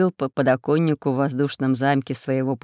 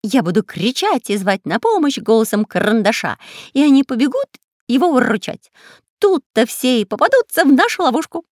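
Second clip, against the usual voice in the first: high, loud parrot cry; shouting parrot; 7 semitones higher, 6 dB louder than usual. Acted. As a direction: squawk it out eagerly. Parrot explains his plan.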